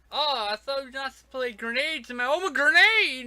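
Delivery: high, loud hammed-up shriek, rising to a bigger cry; mock yell. Camp owner retold as a mortar shell lands in his window, mock shrieking.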